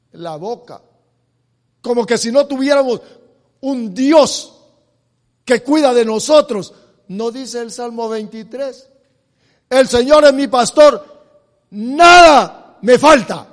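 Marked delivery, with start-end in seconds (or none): 1.8-13.5 loud, ringing sermon delivery; fiery preaching. Acted. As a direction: preach it out to the congregation. Preacher at full force.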